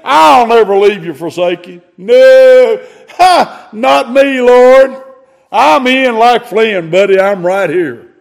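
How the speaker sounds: high, loud swaggering boast; cocky Peter. Retold or imitated. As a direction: puff yourself up before the Lord. Peter's boast acted again.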